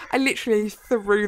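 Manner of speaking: ordinary delivery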